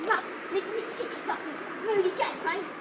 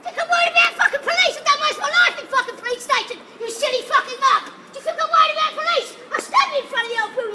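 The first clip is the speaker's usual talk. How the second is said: yelling, much louder and higher, fast; furious shout; 4 semitones higher, 6 dB louder than usual. Real, not acted. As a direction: roar it at him in real rage. Raging at a bystander about police.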